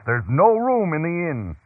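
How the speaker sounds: high, firm refusal. A narrator's reading. Boy innkeeper refuses Joseph a room, firm. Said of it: "Brent said in a firm and deep voice".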